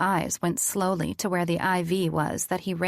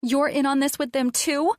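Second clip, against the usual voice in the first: accusing; 8 semitones higher than usual. A narrator's reading. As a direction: hurl the betrayal at her. Patient accusing mother.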